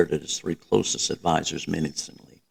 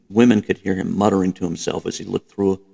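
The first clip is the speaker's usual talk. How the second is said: plain reading voice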